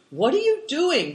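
high call over the shoulder; called back. A narrator's reading. Tolas riding ahead, calling back to the boys. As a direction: call back to someone behind you. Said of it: "Tolas called back".

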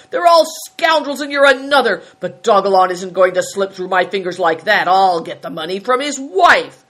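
furious, forceful tirade; angry shout. A narrator's reading. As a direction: rage at all of them. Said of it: "shouted Greencoat".

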